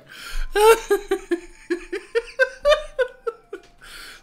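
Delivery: high shrieking laughter, out of control; helpless laughing fit. Real, not acted. Giggling fit while streaming a strategy game, shrieking laughter. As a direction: lose it completely, cackling. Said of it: "suddenly giggling".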